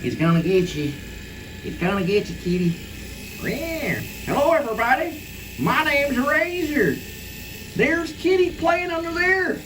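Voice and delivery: put-on high sing-song baby talk to a cat; high pet talk. Talking to his cat Kitty under the furniture, high sing-song pet voice. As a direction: coo and cheer at the kitten like it's a baby.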